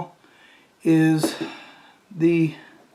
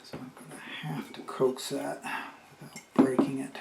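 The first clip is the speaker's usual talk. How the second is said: whispered aside; 7 dB quieter than usual. Real, not acted. Careful fiddly job, muttering.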